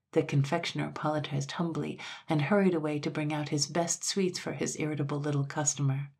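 ordinary delivery

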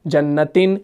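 جنتن ending in "-tin". Shown as a demonstration. Teacher reciting the singular's third case, -in ending on جنتن. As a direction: say جنتن as jannatin, short i before the n. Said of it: "یہ واحد ہے".

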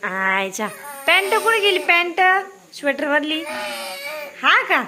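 high, loud excited cooing. Real, not acted. Mother fussing over baby.

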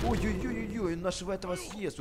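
normal talking voice

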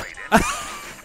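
high, airy burst of laughter; laughing. Real, not acted. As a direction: let out a breathy laugh. Laughing during play.

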